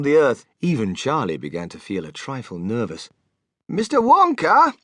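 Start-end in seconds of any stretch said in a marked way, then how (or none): 3.7-4.7 high, loud, slow call; yelling over noise. A narrator's reading. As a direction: call out to someone over a roar. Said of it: "he yelled above the noise".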